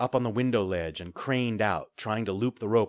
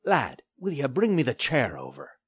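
grunting; 5 semitones higher than usual. A narrator's reading. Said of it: "he grunted".

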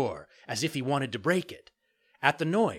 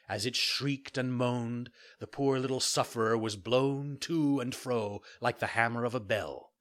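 ordinary delivery, nothing marked

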